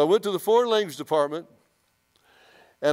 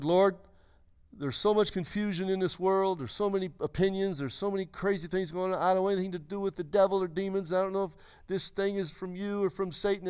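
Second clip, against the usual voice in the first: higher, softer earnest plea to God; simple prayer; 4 semitones higher, 3 dB quieter than usual. Retold or imitated. Confused young believer asking the Lord for clarity, pleading prayer. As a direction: pray aloud in a raised, hushed pitch. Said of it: "I said a simple prayer".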